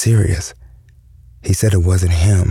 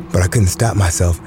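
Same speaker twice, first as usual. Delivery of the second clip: plain reading voice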